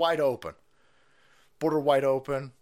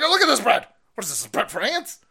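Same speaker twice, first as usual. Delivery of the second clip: high, loud outraged shout; yelling at bread; 8 semitones higher, 7 dB louder than usual. Retold or imitated. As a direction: rant at the tiny loaf like it insulted you. Yelling at bread shrinkage, retold.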